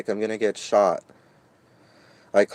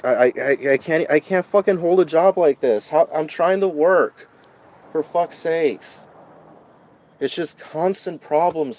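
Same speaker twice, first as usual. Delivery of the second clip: despairing anger; 4 semitones higher, 4 dB louder than usual. Real, not acted. Venting despair after threat.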